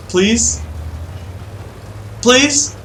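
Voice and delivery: high, rising plea; pleading. Real, not acted. Player begging a teammate to just shoot him, pleading. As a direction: beg with the pitch climbing on each please.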